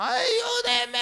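very high sobbing cry; crying for help. Acted. Stranded man calls to passing ship, high sobbing cry. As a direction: scream for help through tears.

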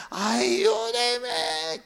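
calling for help. Acted. Castaway calls for help.

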